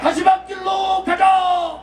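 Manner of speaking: slow, shouted slogan line; roaring rallying speech. Real, not acted. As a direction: lead the crowd chant at full voice. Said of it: "제가 선창할 테니까 따라해 주시기 바랍니다". (천천히 크게 외치는 선창 구호)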